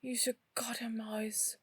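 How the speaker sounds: slow, drawn-out irritation; exasperated retort. Acted. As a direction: drag it out, fed up. Hurt character snapping at Chris.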